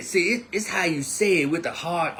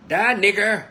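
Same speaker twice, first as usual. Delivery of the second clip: loud, higher hateful shout; the version they were not shouting; yelled version; 7 semitones higher, 7 dB louder than usual. Retold or imitated. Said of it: "They weren't yelling".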